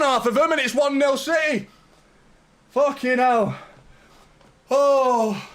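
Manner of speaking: coming down from the scream but still shaking with joy; breathless disbelief. Real, not acted. Fan telling his viewers he still can't believe it. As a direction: still loud and high, buzzing after the goal. Still reeling after the goal.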